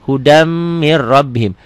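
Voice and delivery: slow "Hudam mir rabbihim", humming m, doubled r; idgham reading. Shown as a demonstration. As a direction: hold the m of "Hudam", then roll into "mir rabbihim". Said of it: "Tulisan dan bacaan beda". ("Hudam mir rabbihim" pelan dengan dengung)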